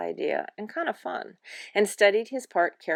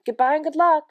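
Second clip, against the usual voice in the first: higher, louder sing-out goodbye; bright farewell; 8 semitones higher, 8 dB louder than usual. Acted. Mrs. Piggle Wiggle sees her visitor off, called-out farewell. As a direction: call out a hearty farewell. Character's farewell call in story.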